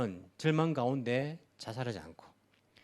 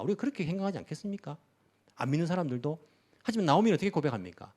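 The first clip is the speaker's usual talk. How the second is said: usual speaking voice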